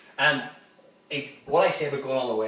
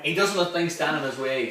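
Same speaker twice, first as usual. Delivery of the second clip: ordinary delivery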